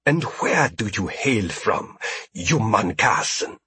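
smooth-talking alien merchant; ingratiating alien trader. A narrator's reading. Alien asks origin.

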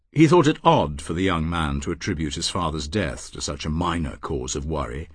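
usual speaking voice

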